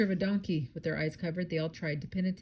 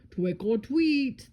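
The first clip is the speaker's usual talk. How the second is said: slow, garbled words round candy; muffled mock mouthful, drawn out and raised; mouth full; 5 semitones higher, 5 dB louder, much slower than usual. A narrator's reading. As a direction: say TWICK OR TWEET with r's turned to w's. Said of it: "mouth was full of candy".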